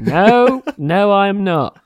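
high, loud, then slow no; flat denial. Retold or imitated. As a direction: deny it outright and drag it out. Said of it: "And I was like".

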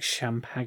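"champagne" said wrong as sham-pag-un-ay; mispronounced champagne. Retold or imitated. Imitating an uncultured character's botched word, "champagne" as sham-pag-un-ay. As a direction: stretch "champagne" into extra syllables, sham-pag-un-ay. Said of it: "She says it wrong".

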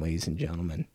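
ordinary talk, nothing marked

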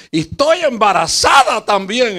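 high, loud mock blurt of the girl; imitating the girl blurting it to the whole church. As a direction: belt it out like a sudden confession. Girl announces pregnancy aloud, quoted.

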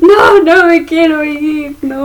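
tearful, drawn-out mock plea; Spider-Man. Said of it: "Spider-Man a Iron Man le dice". (llorando en broma, alargado y agudo)

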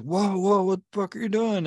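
high-pitched mock yell at a driver; imitated yelling. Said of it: "Why does he keep yelling".

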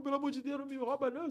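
high mock-pleading voice; scared taxi driver. Taxi driver begging the panicked passenger not to rob him, high pleading.

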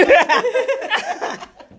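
a burst of high, bright laughter. Real, not acted. Laughing to friend about scarred knee, real high laugh. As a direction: laugh out loud at the memory. Laughing at childhood fall story.

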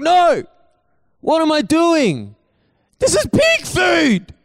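high, loud, drawn-out mock outburst; horrified shout. Son catches himself about to eat pig food, horrified shout. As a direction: yell it slowly, acting out sudden horror.

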